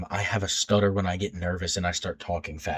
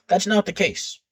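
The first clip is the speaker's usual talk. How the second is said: sharply high, firm denial; emphatic correction; 11 semitones higher, 5 dB louder than usual. Real, not acted. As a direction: insist it's wrong. Correcting own earlier belief.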